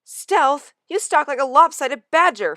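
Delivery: high, loud playful mockery; cat ribbing his friend; teasing. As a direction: poke fun at your friend. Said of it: "Firepaw teased".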